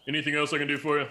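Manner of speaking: a friendly smith asking politely; shopkeeper voice. Acted. Smith turns back.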